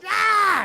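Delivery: high, loud imitated baby scream; baby shriek. Dad imitating his baby daughter refusing the cart, high shriek. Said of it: "and she goes".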